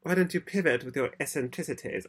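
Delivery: half-successful actress impression; attempted Catherine O'Hara. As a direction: have a go at a famous actress's voice. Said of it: "I can't do the voice".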